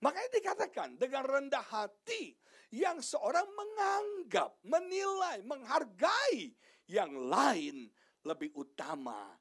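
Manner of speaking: high, loud, drawn-out preaching; preaching at full force. Acted. (khotbah berapi-api, suara tinggi dan lantang)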